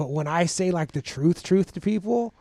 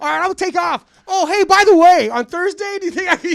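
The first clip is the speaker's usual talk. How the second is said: high, loud, feigned afterthought; hammed-up offhand ask; 9 semitones higher, 6 dB louder than usual. Inmate slipping a favour to the job boss, mock-casual and high. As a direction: toss the favour in lightly like it just occurred. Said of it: "acted sly request".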